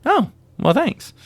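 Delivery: high squeaky sheepish thanks; a tiny high embarrassed thank-you; awkward thanks. Retold or imitated. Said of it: "I went".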